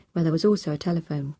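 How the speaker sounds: usual speaking voice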